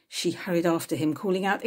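plain reading voice

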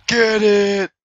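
ordinary talk, nothing marked

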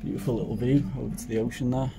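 low, very soft voice; hushed commentary inside a pub; hushed aside. Real, not acted. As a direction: talk discreetly so nobody nearby notices. Filming discreetly inside the pub.

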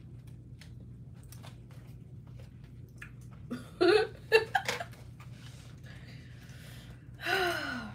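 long breathless laughter. Real, not acted. Laughing imagining husband.

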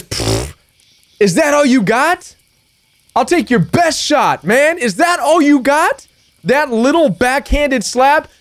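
scornful "is that all you got" taunt; scoffing defiance. Pretend. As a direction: laugh off the insult and dare them. Defiant answer to narcissist.

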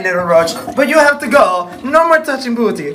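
ordinary talk, nothing marked

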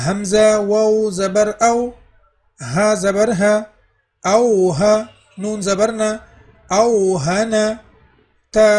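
loud, rhythmic spelling and reading; practice recitation drill. Shown as a demonstration. Teacher leading the practice lines, loud chanted recitation. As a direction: chant each letter and syllable for the class. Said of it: "اسی پانچ line پہ انشاءاللہ ہم practice کریں گے".

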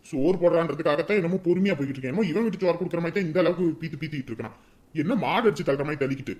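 low, subdued grumble; resentful grumbling. Acted. Man queuing for free food, resentfully grumbling to himself. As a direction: grumble under your breath. Private grumbling while waiting in line.

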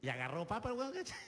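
usual speaking voice

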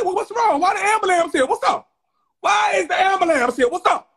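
high, loud urgent questions; alarmed questioning. Retold or imitated. Asking why the ambulance came, alarmed and loud. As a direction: demand answers in shock. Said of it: "I said".

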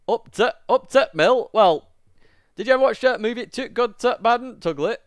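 high, loud northern English accent; hammed-up northern English voice. Shown as a demonstration. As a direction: lay on a broad northern accent, pitched well up. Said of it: "My northern accent".

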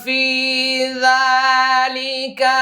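slow chanted tilawat, vowels held long; Quran recitation. Shown as a demonstration. Teacher models the full verse after spelling it out, chanting. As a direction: lift the phrase into a melodic chant.

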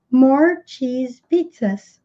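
very slow, word by word; slow teaching read. Shown as a demonstration. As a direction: model it slowly for the class. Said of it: "teacher repeats slowly".

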